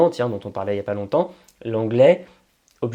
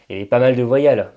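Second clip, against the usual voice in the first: usual speaking voice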